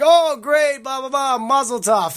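put-on high, breezy Israeli accent on the congratulations. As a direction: imitate an Israeli friend saying mazel tov. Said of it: "Israeli accent".

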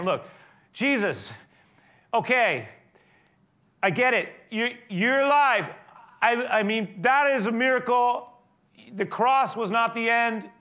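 high, loud desperate cry of a broken man; voicing Peter's anguished plea. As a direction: cry it out as Peter confessing weakness, slowing on key lines. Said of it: "it's almost like he's saying".

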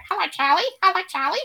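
high, loud squawking parrot imitation; cockatoo voice. Imitating the zoo cockatoo greeting its keeper, high loud squawk. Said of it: "So he'd go".

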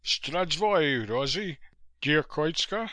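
brisk and businesslike. A narrator's reading. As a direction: say it briskly, with some push. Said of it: "His voice said briskly".

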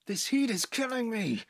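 high, sudden outburst; bursting out. Acted. Garcia exploding at the heat in the room, outburst. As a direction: blurt it out, fed up. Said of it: "Garcia burst out".

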